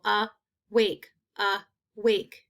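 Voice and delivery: awake in two separate beats; split into syllables. Shown as a demonstration. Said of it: "I identified the first syllable".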